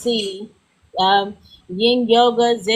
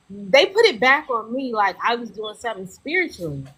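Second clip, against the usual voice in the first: ordinary talk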